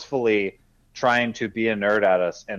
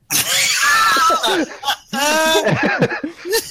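burst of high laughter; 6 dB louder than usual. Real, not acted. Big laugh at the story.

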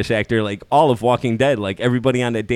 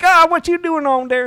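high, twangy hillbilly accent, hammed up; 4 dB louder than usual. Friend mimics a hillbilly accent in a bar, hammed-up hillbilly accent. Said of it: "hillbilly accent".

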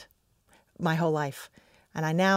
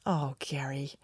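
breathy, softer whispered sigh of a name; a touched, hushed reply to the host; 3 semitones lower, 5 dB quieter than usual. Real, not acted. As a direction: whisper it, caught off guard. Host asks a sentimental question.

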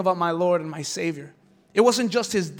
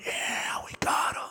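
gleeful demonic whisper; 6 dB quieter than usual. Acted. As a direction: hiss it like a scheming demon. Demons voiced gloating.

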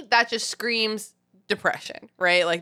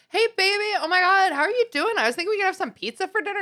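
much higher, bubbly sing-song greeting, fast at the end; chipper greeting; 12 semitones higher, much faster than usual. Retold or imitated. Wife greeting grumpy husband home, high and bubbly. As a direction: gush brightly at a tired partner. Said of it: "and I'm like".

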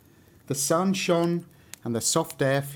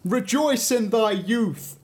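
high, slow, bright proclamation; radiant sunbeam; 8 semitones higher, 4 dB louder, slower than usual. A narrator's reading. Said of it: "said the sunbeam".

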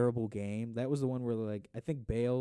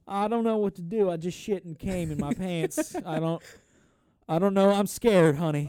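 high, loud hammed-up fear; terrified husband; 8 semitones higher, 9 dB louder than usual. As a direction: whimper it out as a scared, lost husband. Mock Texan husband, terrified.

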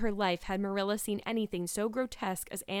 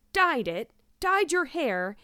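louder, incredulous echo, rising higher; shocked; 7 semitones higher, 6 dB louder, slower than usual. A narrator's reading. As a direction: throw the words back in shock. Marilla shocked by the confession.

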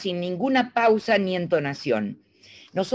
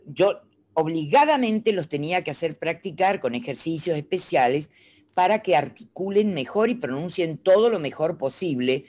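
ordinary talk

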